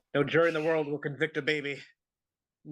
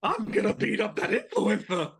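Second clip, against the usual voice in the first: put-on boxer voice, a little higher; hammed-up boxer impression; Mike Tyson; 11 semitones higher than usual. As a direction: boast like a prizefighter promising a beating. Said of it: "Mike Tyson".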